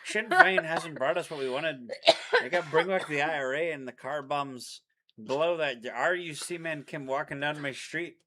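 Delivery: mock Irish accent. Boyfriend ranting to his girlfriend as an Irish rebel in a mock accent. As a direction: rant like a furious Irish republican. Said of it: "you just sound like an Irishman".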